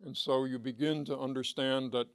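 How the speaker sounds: usual speaking voice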